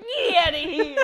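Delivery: put-on high, loud cranky old man yelling; grumpy old man. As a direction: shake your fist and shout at the kids. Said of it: "He's like".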